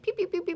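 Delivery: high playful pew pew; mock gunshots, squeaky and high; childish pew pew sound effect; finger gun pew noises. Shown as a demonstration. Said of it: "where you go like".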